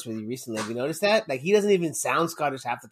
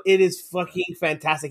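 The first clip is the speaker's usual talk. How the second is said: ordinary delivery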